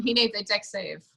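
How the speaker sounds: ordinary delivery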